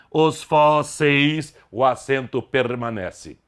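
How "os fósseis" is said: "os fósseis" slowly, with FÓ held long; stress on penultimate syllable. Shown as a demonstration. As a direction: draw out both syllables of "fósseis", stressing the first. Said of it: "sílaba tônica é a penúltima". ("fósseis" bem devagar, com a tônica FÓ alongada)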